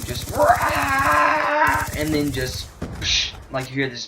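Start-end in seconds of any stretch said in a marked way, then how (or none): 0.4-1.8 loud, high yell cut short; imitated scream. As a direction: act out a friend's sudden cry. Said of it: "we hear another scream".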